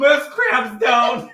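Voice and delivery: high, loud, drawn-out squeal; helpless laughter. Real, not acted. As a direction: shriek the joke through laughter. Joke during laughing fit.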